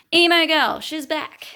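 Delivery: loud, high showman announce; announcer voice. Pretend. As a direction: boom it like a game-show host. Announcing recurring character.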